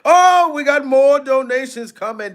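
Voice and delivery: high, hyped donation call; excited announcement. Real, not acted. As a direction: announce it like a happy surprise. Hypes another incoming donation.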